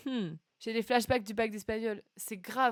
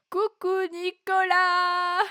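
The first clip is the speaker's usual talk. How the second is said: drawn-out, loud and higher coucou; excited greeting; 7 semitones higher, 8 dB louder, much slower than usual. Real, not acted. Streamer greeting her regular viewer Nicolas, drawn-out and excited. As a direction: call out happily to a favourite viewer.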